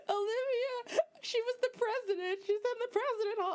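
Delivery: sobbing over the finale; ugly crying. Retold or imitated. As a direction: blubber through tears at the TV. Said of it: "I was, like, ugly crying".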